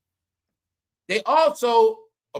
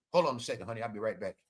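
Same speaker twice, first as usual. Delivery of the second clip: much lower and softer, sheepish excuse to the wife; mock husband; 12 semitones lower, 9 dB quieter, faster than usual. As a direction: play a man stalling in bed. Imitating man excusing himself.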